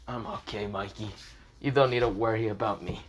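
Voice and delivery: quiet, weakened reassuring voice; soft reassurance. Acted. Hurt Draken telling Mikey not to worry, softly. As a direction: reassure a worried friend softly.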